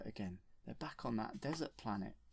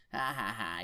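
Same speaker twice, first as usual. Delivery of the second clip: mocking fake laugh; put-on villain's ha-ha-ha; Jabba the Hutt; 4 dB louder than usual. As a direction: laugh scornfully as the alien boss. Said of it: "Jabba the Hutt just laughs and says".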